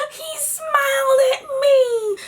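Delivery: high, slow, breathless and teary; awestruck. Retold or imitated. Girl overwhelmed that Muhammad Ali smiled at her, tearful awe. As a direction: gasp it out in disbelief.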